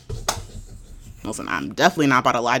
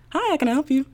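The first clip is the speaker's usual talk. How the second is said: customer service voice; 12 semitones higher, much faster than usual. Retold or imitated. Answering the phone at work.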